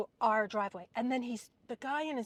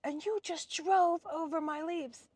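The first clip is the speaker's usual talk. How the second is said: higher, slow, put-on complaining voice; mimicking the neighbor lady; 6 semitones higher, slower than usual. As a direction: drag out her outrage in a higher pitch. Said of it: "And she's like".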